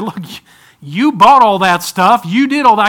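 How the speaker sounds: firm finger-wagging counsel; stern rebuke. Retold or imitated. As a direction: lay the blame back on him.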